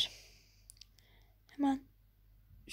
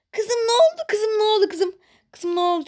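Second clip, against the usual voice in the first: higher, louder alarmed calls; panicked mother; 7 semitones higher, 7 dB louder than usual. Acted. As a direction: rush in asking in alarm.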